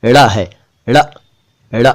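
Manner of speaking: ड़ as a quick retroflex flap; flapped ड़. Shown as a demonstration. Teacher contrasting it with र, retroflex ड़. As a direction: flick the tongue down from the palate on ड़. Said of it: "और ये".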